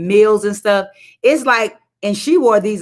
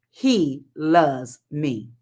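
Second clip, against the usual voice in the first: slow, savouring each word He loves me; dreamy echo; 4 semitones lower, 3 dB quieter, much slower than usual. Retold or imitated. Echoing the old wife's words, slow and dreamy. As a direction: linger on it like a sweet memory.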